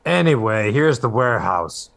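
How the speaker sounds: brisk, loud interjection; calling out. Acted. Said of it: "from the back of the boat".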